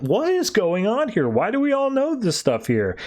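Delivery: high-pitched, bewildered questioning; baffled disbelief. Retold or imitated. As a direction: voice mock bewilderment, pitched well up. Confused heroes question their shared memory.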